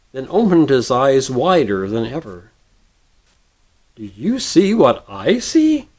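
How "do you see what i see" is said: exclaiming. A narrator's reading. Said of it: "he exclaimed".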